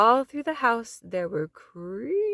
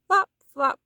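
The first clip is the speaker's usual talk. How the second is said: flop flop; 8 semitones higher, 7 dB louder than usual. A narrator's reading. Sound effect.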